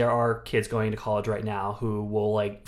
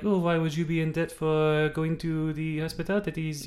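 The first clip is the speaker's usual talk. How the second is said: put-on Nordic accent; 5 semitones higher than usual. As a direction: lightly imitate a Scandinavian interviewee. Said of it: "I can do that accent".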